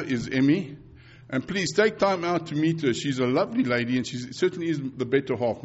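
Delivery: usual speaking voice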